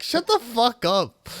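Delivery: high, loud exasperated snap back; hammed-up exasperation; mock angry retort. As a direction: tell the character off, loud and high.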